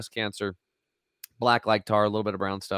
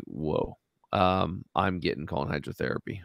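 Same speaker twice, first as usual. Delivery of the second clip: stunned hush; 5 semitones lower, 6 dB quieter than usual. Retold or imitated. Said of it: "then I'm just like".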